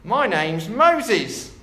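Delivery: ordinary talk, nothing marked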